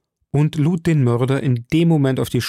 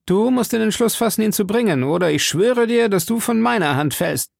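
raised, sworn threat; young D'Artagnan threatening; 9 semitones higher than usual. A narrator's reading. D'Artagnan to the bandit, a higher, threatening oath. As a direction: swear he will die by your hand.

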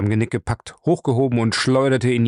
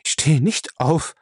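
defiant refusal; 5 semitones higher than usual. A narrator's reading. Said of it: "stöhnte Lacey trotzig".

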